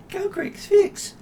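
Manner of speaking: tiny, high, slow little voice; small voice. Acted. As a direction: squeak it out like a tiny creature. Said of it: "you hear a little voice going".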